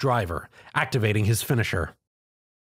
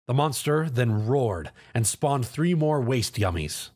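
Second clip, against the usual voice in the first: ordinary delivery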